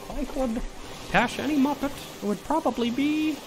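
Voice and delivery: high, froggy puppet voice held throughout; Kermit voice. Acted. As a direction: chirp it out like a felt frog. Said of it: "I'll say goodbye to Kermit".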